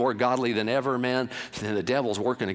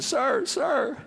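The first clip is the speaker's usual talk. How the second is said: starts high, then slow and fading to soft; little girl pleading with the buyer; 6 semitones higher, 4 dB quieter, much slower than usual. A narrator's reading. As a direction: beg him from breathless to quiet. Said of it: "running after him going".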